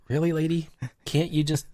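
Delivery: high, exasperated disbelief; indignant. Retold or imitated. Wife glaring at hoarder.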